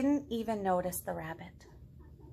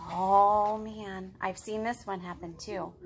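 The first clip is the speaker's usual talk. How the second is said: ordinary delivery, nothing marked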